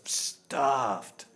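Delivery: "stuffed" stretched long and slow; slow, heavy stress on "stuffed"; drawn-out emphasis. Real, not acted. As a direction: draw out "stuffed" as if overfull. Describes Ganesh's full belly.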